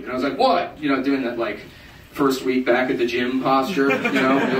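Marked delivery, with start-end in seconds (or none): 0.4-0.8 high, sharp tough-guy what; defensive snap. Retold or imitated. Drunk comic snapping back at the stranger, high and sharp. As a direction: bark it back with gym swagger.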